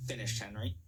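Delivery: plain reading voice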